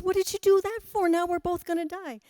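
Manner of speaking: high, fast, stung turtle crying out; outraged turtle. Acted. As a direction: squeal it out as the betrayed turtle.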